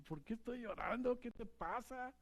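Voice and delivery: a hint of a confused young man crying at night; tearful self-question. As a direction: ask yourself through tears, softly. Retelling own night crying.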